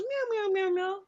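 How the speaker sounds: high meowing like a kitten; cat meows. Retold or imitated. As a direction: meow like a little girl playing cat. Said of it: "She goes".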